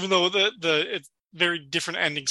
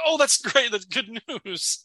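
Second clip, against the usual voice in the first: mock delighted Cliff; 6 semitones higher, slower than usual. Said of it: "He's like".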